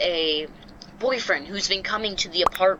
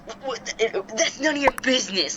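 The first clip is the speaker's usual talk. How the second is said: flustered stammer, caught out; stuttering; 5 semitones higher than usual. Retold or imitated. Tenant caught lying, stammering at the landlord. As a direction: stumble over the words defensively. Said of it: "she, like, stutters".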